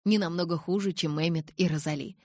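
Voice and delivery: usual speaking voice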